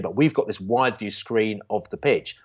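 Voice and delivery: ordinary delivery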